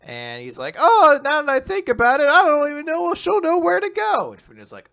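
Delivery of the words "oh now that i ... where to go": high, loud put-on reply; retold sudden realization. Playing the dad replying to a message, high and loud put-on voice. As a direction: pitch it way up, mock-pondering.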